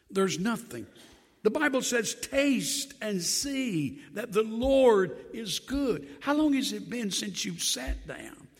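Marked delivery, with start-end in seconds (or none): 2.1-6.1 slow, savoured scripture line; slow scripture quote. Acted. Preacher quoting the Bible slowly to the church. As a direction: draw out each word of the verse.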